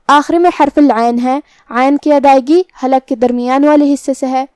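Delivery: ordinary talk, nothing marked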